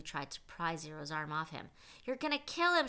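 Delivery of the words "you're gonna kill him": high loud alarmed shout. A narrator's reading. Counselor yelling at Zero to let go, alarmed shout. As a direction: yell it in panic. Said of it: "shouted Mister Pendanski".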